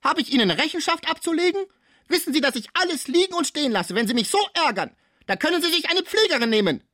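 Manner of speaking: very high, loud, furious tirade; outraged yelling. Acted. Cibot threatens to quit nursing Pons, outraged yelling. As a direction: shriek your threat to walk out. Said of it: "rief die Cibot".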